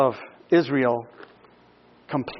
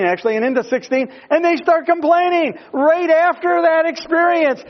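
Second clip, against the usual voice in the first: high, loud, rising sermon climax; full-force preaching; 7 semitones higher, 4 dB louder than usual. Acted. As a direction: preach it hard to the congregation.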